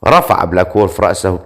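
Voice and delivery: ordinary delivery, nothing marked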